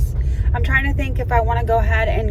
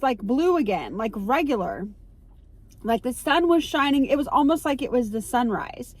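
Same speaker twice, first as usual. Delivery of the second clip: ordinary talk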